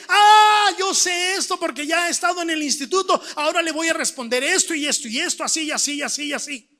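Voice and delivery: loud high "ah", then rapid-fire rattle; mock eureka. Student recalling the institute's answers, mock confident and fast. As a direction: burst in as if remembering, then race through it. Mimics an imagined know-it-all reaction.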